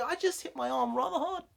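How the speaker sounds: wincing after hitting arm; pained, hurt aside. Real, not acted. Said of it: "My arm is really hurting".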